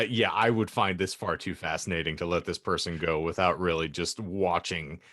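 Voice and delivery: ordinary delivery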